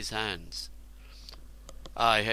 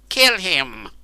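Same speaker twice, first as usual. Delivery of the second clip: sharp raised shout, higher and louder; cried out; 6 semitones higher, 8 dB louder than usual. A narrator's reading. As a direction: bark the order to kill. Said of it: "cried Cernus".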